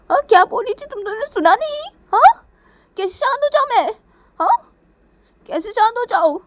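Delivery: loud, very high-pitched indignant outburst; acted fury. Angry mother answering friends who say calm down, shrill furious retort. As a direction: shriek back in outrage at being told to calm down. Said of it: "Manisha बहुत ही गुस्सा हुई थी".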